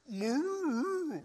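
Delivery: high mooing stomach rumble; a comic gut-growl moo; playful cow-like stomach noise; imitated stomach growl. Stomach growl in silent tomb.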